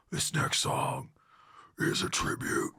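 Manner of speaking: growled stage dedication; death metal voice. Retold or imitated. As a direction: rasp it out like a metal singer addressing the crowd. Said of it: "So this is how it would go".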